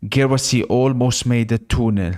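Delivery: usual speaking voice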